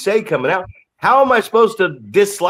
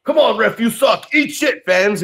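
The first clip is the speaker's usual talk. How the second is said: high, jeering heel voice; heel taunt; 7 semitones higher than usual. Pretend. Heel wrestler jeering at the ref and crowd, high. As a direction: sneer at the ref and fans.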